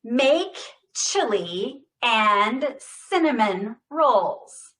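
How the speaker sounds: slow, loud, every word hit with intention; loud deliberate speech-therapy demo; every word stressed. Shown as a demonstration. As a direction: say each word fully and clearly. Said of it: "say every word with intention".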